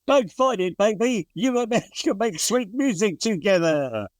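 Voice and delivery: high smooth suave cartoon cat, laughing; Cary Grant cartoon cat. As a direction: purr it like a charming cartoon cat. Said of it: "a very good impression of a cartoon cat being Cary Grant".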